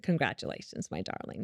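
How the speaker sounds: hushed, low congratulations; soft husband voice. Retold or imitated. Husband congratulating his wife, hushed and low. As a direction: whisper it lovingly like a proud husband. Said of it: "and he said".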